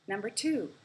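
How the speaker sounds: ordinary talk, nothing marked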